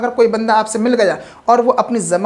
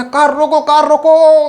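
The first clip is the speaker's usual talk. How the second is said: raised urgent call to stop the car; urgent shout; 5 semitones higher, 6 dB louder, slower than usual. Acted. Passenger yelling at a speeding driver to stop, urgent shout.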